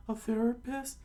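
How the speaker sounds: very high falsetto question; high cartoon puzzlement; confused listener. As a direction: ask it in squeaky confusion. Mishearing acted in the story.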